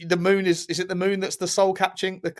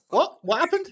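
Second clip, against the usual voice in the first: retold surprise; 8 semitones higher than usual. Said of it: "And I was like".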